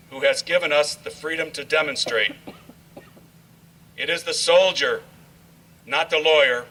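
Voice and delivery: solemn recitation. Acted. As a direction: proclaim each line like a memorial tribute. Recites patriotic poem at ceremony.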